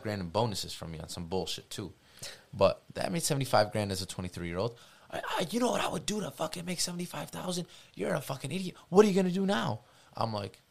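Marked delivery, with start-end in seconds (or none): 5.1-9.8 furious grandfather. Retold or imitated. Grandfather flipped, retold.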